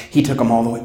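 normal talking voice

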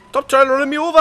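high, loud panicked shout; frantic high shout at a runaway car; panicked yell. Real, not acted. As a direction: yell it at the car that is chasing you. Panicked yelling at NPCs.

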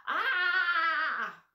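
high frightened cry of Ah; scared shout. Shown as a demonstration. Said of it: "we say that loudly because we're scared".

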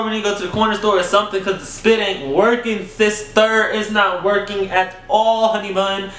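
put-on high, exasperated sister-girl rant; scolding her partner to go to the store; sassy scolding. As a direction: tell him off with attitude. Scolding partner about no lube.

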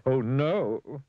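acted aghast exclamation; gasping in dismay. A narrator's reading. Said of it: "he gasped".

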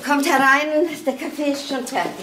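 a lively welcome to the visitors; bright greeting. Acted. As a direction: call them in gladly. Greeting family at the door.

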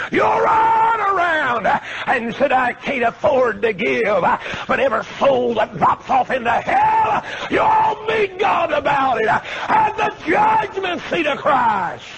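high, slow shouted preaching to the rafters; preaching at full force. Acted. Preacher rebuking stingy members, shouting at full force. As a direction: lash the stingy ones at top voice.

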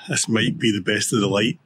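ordinary talk

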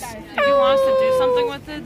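high and loud, calling across the street. Real, not acted. A shouted question to the streamer. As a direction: friend shouting a question over the noise, loudly. Friend calls out over street noise.

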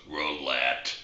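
a low, slow little frog calling out the club; talking frog. Acted. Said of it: "frog goes".

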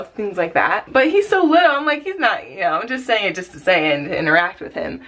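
ordinary talk, nothing marked

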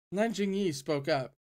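usual speaking voice

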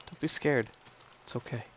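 usual speaking voice

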